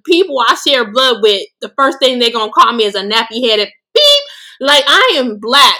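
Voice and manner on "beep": high, level beep; self-censor bleep. Real, not acted. Quoting the insult her family calls her, bleeped out. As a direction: bleep out the swear word on one high note.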